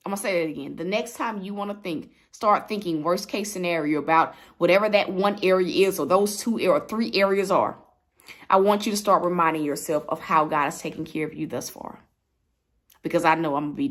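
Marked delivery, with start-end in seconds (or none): none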